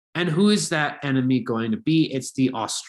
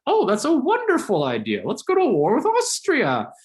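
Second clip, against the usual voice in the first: high, hammed-up eager Bismarck, louder; mock Bismarck; 11 semitones higher, 3 dB louder than usual. Teacher voicing Bismarck pitching war to northern states, high mock enthusiasm. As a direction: sell the war like it's a delightful idea. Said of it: "and Bismarck's like".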